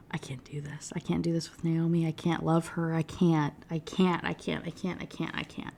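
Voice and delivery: breathless, hushed panic, fading to a whisper; panicked whisper. Retold or imitated. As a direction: whisper it like you're spiraling and can't go on. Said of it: "he's like".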